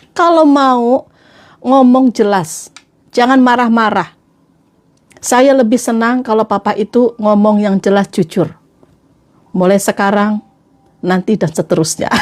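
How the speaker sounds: measured, solemn wife laying down terms; very serious. Acted. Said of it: "Serius banget ngomongnya". (istri bicara serius sekali)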